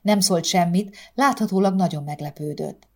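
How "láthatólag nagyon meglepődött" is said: ordinary delivery, nothing marked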